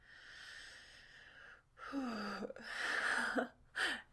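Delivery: big, drawn-out exhale; long breathy sigh. Acted. Speaker after agreeing to stay, long breathy sigh.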